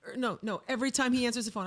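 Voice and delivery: normal talking voice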